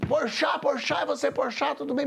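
high, eager calling of his name; excited fans. Retold or imitated. Young Brazilian fans greeting the host in the elevator, high and excited. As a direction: mimic young fans spotting a celebrity.

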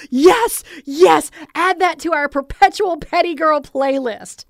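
high, loud and eager; excited agreement. Retold or imitated. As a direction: gush yes with delight. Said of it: "you're like".

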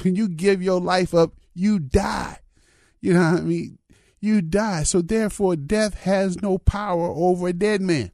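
rising sermon fervor; preaching. Acted. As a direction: preach it to the congregation. Pastor preaching rises.

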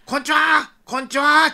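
put-on high, calling children's hello; big-voiced greeting. Children facing the patrol car, big-voiced hello. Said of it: "ふっとか声で".

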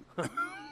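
short high-pitched laugh. Real, not acted. Co-host joking about his sleeping wife, short laugh. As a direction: laugh at your own joke.

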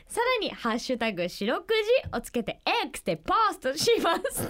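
steady radio info read; radio announcement read. Acted. Co-host reads how to send in letters, as a radio announcement. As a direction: read the contact details like a station notice.

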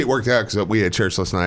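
ordinary talk, nothing marked

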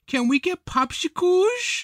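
put-on high, slow kid voice; toddler voice. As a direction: ask like a pleading child. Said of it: "kept saying".

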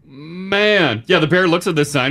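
ordinary delivery

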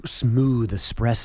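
low smooth seductive ad voice; sultry narrator. Pretend. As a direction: purr it like a sexy ad read. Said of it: "Do you have to do it in a sexy voice?".

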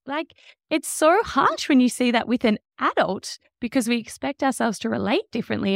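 ordinary talk

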